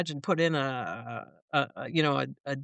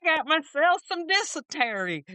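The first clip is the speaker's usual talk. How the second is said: higher-pitched, joking through laughter; laughing; 9 semitones higher than usual. Real, not acted. Guest joking back to laughing cohost about food poisoning, laughing. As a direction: play along with the gag while laughing.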